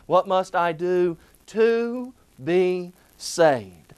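slow, with "saved" weighted; slow and deliberate, ending on "saved"; stress on SAVED. Shown as a demonstration. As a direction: lean on the last phrase. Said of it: "that last phrase".

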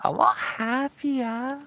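high chirpy fake cheer; put-on happy voice. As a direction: sound falsely bubbly. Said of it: "Debbie Downer voice is tilting me".

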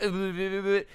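high mock shuddering zap noise; imitated electrocution noise. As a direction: buzz like a cartoon zap victim. Imitates someone being electrocuted.

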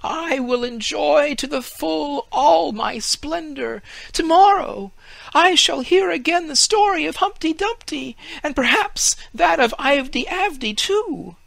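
a naive tree marvelling aloud; tree's thoughts. A narrator's reading. Fir tree marvels at the Humpty Dumpty story, high and awed. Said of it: "thought the fir tree".

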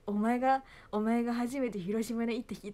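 lightly mocked, loud letter reading; dad's letter. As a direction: punch the rough address as if quoting dad. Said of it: "初めての生誕の手紙パパからで". (父の手紙を軽く真似た読み上げ)

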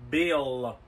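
slow and clear. Acted. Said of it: "I didn't quite get that".

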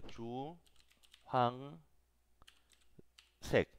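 Chu Hang Sek with a pause between each syllable; split into syllables. Shown as a demonstration. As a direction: break Chu Hang Sek into three beats. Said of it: "así como hace rato".

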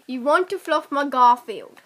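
lightly attempted Cockney accent. Player repeats a sentence for friend to guess, Cockney accent.

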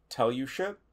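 usual speaking voice

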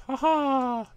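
high, loud, hammed-up triumphant laugh; mock victorious laugh; gloating villain laugh. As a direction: cackle at the trap. Gloating after escaping a trap.

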